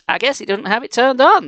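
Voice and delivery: breezy heroic reassurance; calm superhero. A narrator's reading. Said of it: "said calmly".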